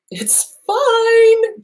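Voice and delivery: high, drawn-out FINE, held on one note; hammed-up insistence that all is okay; mock denial. Quoting denial of the it's-fine camp.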